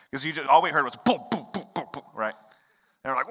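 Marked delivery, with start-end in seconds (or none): 1.0-2.3 quick run of booming thuds; imitated tumbling thuds. Uncle mimicking his niece tumbling downstairs, thudding booms. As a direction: make the sound of a fall downstairs.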